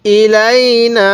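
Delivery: ইলাইনা chanted slowly with the long vowel held for three counts; slow Quranic chant of ইলাইনা; elongated recitation. Shown as a demonstration. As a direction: draw out ইলাইনা in slow tajweed style. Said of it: "এখানে তিন আলিফ টান দিব".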